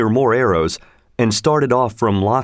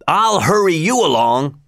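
angry cry; 5 semitones higher than usual. A narrator's reading. Drunken forester shouts at Robin while shooting, higher. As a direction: snarl it as he looses the arrow. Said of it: "he cried".

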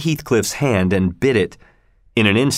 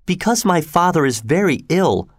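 put-on higher-pitched girl's impatient reply; impatient young woman, pitched up; 7 semitones higher than usual. A narrator's reading. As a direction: answer impatiently in a raised pitch. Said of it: "said Kathy impatiently".

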